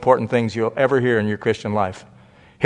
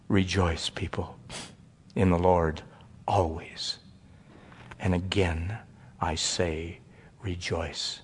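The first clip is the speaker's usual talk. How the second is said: low, much softer reverent reading of scripture; quiet recitation; 6 semitones lower, 11 dB quieter, slower than usual. Acted. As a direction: let the verse fall softly, reverently.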